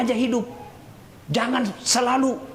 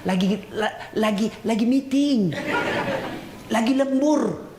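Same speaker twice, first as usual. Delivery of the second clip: stammering excuse. Retold or imitated. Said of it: "Ditelepon istrinya alasan".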